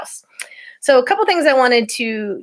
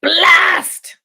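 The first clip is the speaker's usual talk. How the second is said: high, loud captain's curse; roar; 11 semitones higher, 7 dB louder than usual. Acted. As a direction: bellow it out in fury. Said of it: "roared Captain Swain".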